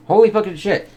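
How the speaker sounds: a high, loud holy shit of awe; amazed outburst. Real, not acted. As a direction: blurt out real astonishment. Reacting in awe to scene.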